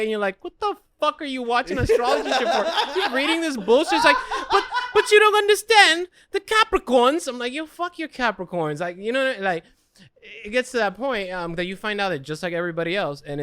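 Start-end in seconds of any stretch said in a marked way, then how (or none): 0.4-3.9 higher, louder disbelief through laughter; laughing mock outrage. Boyfriend's mock outburst. 4.5-7.3 much higher, louder, slow whine; whiny girlfriend voice. Retold or imitated. 7.5-8.8 higher, slow scoffing brush-off; mock dismissive. Boyfriend's mock reply.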